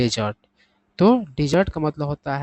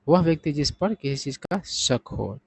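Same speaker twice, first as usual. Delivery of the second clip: ordinary delivery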